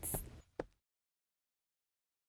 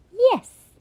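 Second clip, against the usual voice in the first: shout; 4 dB louder than usual. Acted. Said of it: "they both shouted".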